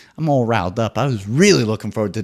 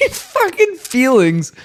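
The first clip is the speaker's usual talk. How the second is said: much higher, slow, scornful drawl; scornful sneer; 14 semitones higher, 4 dB louder, much slower than usual. Real, not acted. Mocking the contestant's talk of feelings, scornful high drawl.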